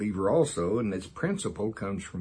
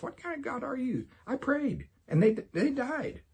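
very high-pitched re-enacted complaint; accusing God. Teacher acting out his old doubts to God, accusing. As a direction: plead in protest, voice pitched way up. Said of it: "when I had those same kind of thoughts".

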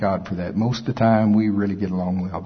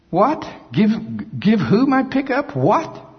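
mock outrage; 10 semitones higher than usual. Mock outraged reply to God.

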